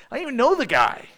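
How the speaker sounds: an exasperated retold denial; frustrated denial. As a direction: snap it out in frustration. Peter cursing, frustrated denial.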